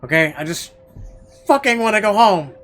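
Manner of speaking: fed-up rant. Retold or imitated. Shopper fed up after hours at the store, angry rant. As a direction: vent like you're sick of errands. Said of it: "you're just like".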